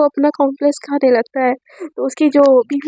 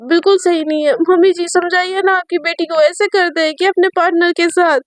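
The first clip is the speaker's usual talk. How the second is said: higher, put-on teasing girlfriend voice; pouting girlfriend voice, higher pitched; Sejal's voice; 4 semitones higher than usual. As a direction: tease her in a higher sulky voice. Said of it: "तो संजय बोलती है".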